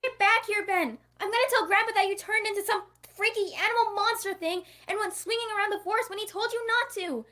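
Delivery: shouting high and loud after him; angry yell. Acted. Sister screaming threats after her runaway cousin, angry yell. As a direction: yell it across the forest, furious and tattling.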